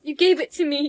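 usual speaking voice

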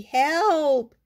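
high, loud, stretched cry; crying for help. A narrator's reading. Froggy in the pond to his mother, crying out. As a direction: call out for rescue like a child. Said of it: "cried Froggy".

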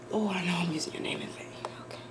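quick, hushed aside to God; low whisper, fast; whispered prayer. Real, not acted. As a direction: whisper it apologetically.

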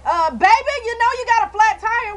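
nosy neighbor yelling about the tire. Retold or imitated. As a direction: holler up at the window like the neighbor. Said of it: "Why you got to yell?".

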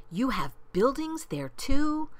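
high, amazed question; gasped surprise. A narrator's reading. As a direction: lift the voice in astonishment. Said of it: "Horton gasped".

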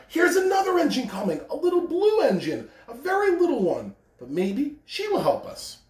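high, excited call. A narrator's reading. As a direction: spot it and shout the news. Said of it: "the little clown called out".

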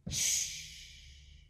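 soft, drawn-out hushing; long shush. Pretend. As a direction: hush the little ones gently. Ends the Quiet Time rhyme.

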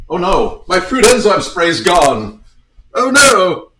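high, loud wail of dismay; mock dismay. Host missing his fruit enzyme spray, in mock dismay.

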